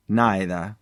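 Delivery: ordinary delivery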